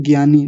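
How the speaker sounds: ज्ञानी said gyaani with a hard g; common gyaani. Shown as a demonstration. Teacher imitates the common ज्ञानी. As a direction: popular gyaani. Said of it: "क्या कहते हैं".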